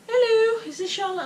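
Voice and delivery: high, bright mock receptionist voice; mimicking the nurse on the phone; phone caller voice. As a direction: imitate the caller's chirpy phone greeting.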